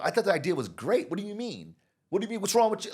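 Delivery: high, loud offended protest; defensive outburst. Retold or imitated. Colleague hurt by criticism, high loud indignant protest. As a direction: play the wounded person who takes feedback personally. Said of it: "You go".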